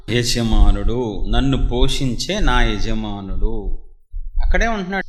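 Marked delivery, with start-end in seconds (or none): 0.1-3.8 నా యజమానుడు drawn out slowly and low; stressed phrase. Shown as a demonstration. Preacher lingers on నా యజమానుడు to stress why he said it. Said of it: "పోషిస్తున్నాడు కాబట్టి నా యజమానుడు అంటున్నాడు".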